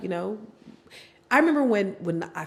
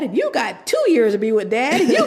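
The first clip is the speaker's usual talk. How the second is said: rising, louder laughing list; laughing countdown; 7 semitones higher, 8 dB louder than usual. Retold or imitated. Mother laughing while retelling her daughter counting years left.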